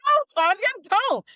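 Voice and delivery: mock scolding. Stopping friend Tanya from helping, mock scold. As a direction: yell at her to stay put.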